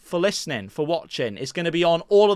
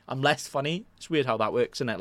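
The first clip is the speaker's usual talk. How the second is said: usual speaking voice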